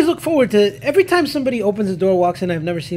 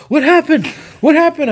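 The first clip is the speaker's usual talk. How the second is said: dazed mock confusion; 8 semitones higher, 3 dB louder than usual. Dazed guy waking, retold.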